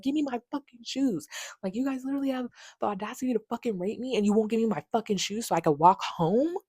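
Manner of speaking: high, hushed mock scream; retold angry cussing. Cussing them out over the stolen shoes, retold outrage. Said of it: "Like, I'm literally screaming. I'm cussing them out".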